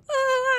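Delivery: high, loud scream; startled scream. Real, not acted. Struggling in the game.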